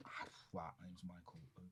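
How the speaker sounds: soft, unvoiced and stunned; awed whisper. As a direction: breathe it out in disbelief. His own stunned reply retold.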